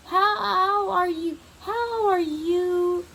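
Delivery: put-on high, slow, stunned old voice; grandma repeating, dazed. Grandma stares at Vanessa, repeating slowly in high shock. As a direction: drag each word out in disbelief. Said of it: "Grandma kept repeating".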